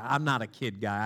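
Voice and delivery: ordinary delivery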